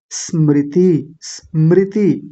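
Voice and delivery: स्मृति slowly, light s held before mriti; correct स्मृति. Shown as a demonstration. Teacher modelling for students, slow careful स्मृति. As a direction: stretch स्मृति from a bare half s. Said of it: "तो क्या बोलेंगे?".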